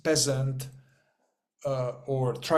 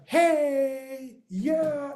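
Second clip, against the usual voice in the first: much higher, airy mock friendly greeting; mock naive cheer; 10 semitones higher than usual. Naive worker greeting everyone at work, mock sugary cheer. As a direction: parody a carefree innocent. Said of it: "you are not gonna be like".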